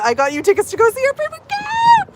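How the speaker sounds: imagined squealing big reveal; excited surprise reveal. Pretend. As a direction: pitch soaring into a squeal. Imagined surprise announcement to her husband.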